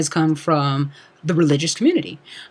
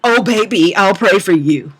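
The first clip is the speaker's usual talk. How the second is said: mock pitying prayer; 4 dB louder than usual. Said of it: "sadly stare at somebody".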